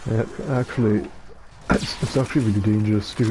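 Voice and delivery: normal talking voice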